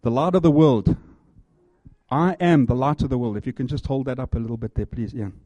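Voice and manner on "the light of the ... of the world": loud, ringing declaration; proclaiming. Acted. Preacher to congregation as Ian holds up the sign, loud. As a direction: call it out across the hall.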